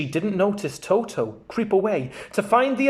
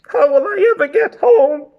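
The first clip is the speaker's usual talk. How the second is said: 1 sobbing; 9 semitones higher, 7 dB louder than usual. Acted. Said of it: "she sobbed".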